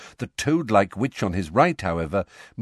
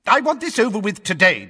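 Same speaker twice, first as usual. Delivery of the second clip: higher, louder official lecturing the court; pompous blustering minister; 6 dB louder than usual. A narrator's reading. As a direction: lay down the rules like an irritated official. Fudge insisting.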